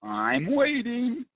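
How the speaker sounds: high, slow, sing-song "I'm waiting"; playful mock impatience. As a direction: tease the audience for being slow to answer. Host waits for chat replies.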